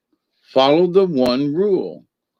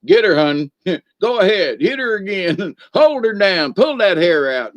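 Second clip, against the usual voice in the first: egging her on from the sideline, laughing; gleeful sideline cheering, pitched up; cheering men on the sideline; 7 semitones higher than usual. Retold or imitated. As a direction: hype up the fight like a rowdy spectator. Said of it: "standing on the sideline going".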